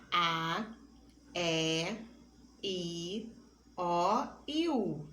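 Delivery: each vowel said slowly and clearly on its own; drawn-out vowel demo. Shown as a demonstration. Teacher slowly sounds out A, E, I, O, U for pupils. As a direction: spell the vowels out one by one for the class. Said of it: "As vogais são representadas pelas letras".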